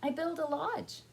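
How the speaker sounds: high, loud story beaver; beaver character. A narrator's reading. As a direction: announce it as the busy beaver. Says the beaver.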